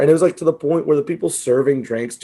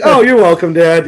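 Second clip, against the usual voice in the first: loud, high sarcastic "you're welcome"; 4 semitones higher, 9 dB louder than usual. Retold or imitated. Son mocking his ungrateful dad's complaint, sarcastic and loud. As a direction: throw the thanks back at him, mock-bright and loud. Dad complains about gift seats.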